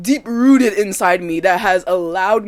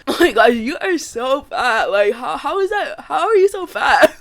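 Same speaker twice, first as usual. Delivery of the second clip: imitating a teasing dad, pitch and volume climbing; mock incredulous jeer ending in a laugh; joking mockery; 5 semitones higher, 5 dB louder than usual. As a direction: act out a laughing tease. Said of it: "it's, like, in a joking one".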